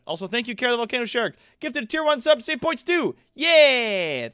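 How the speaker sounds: high, loud and thrilled; excited thanks. Real, not acted. Streamer thanking a viewer for a gift, excited. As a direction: gush over the gifted sub.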